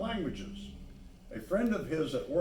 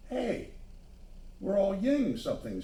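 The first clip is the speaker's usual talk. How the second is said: delighted; 6 semitones higher than usual. Acted. Excited child discovers names.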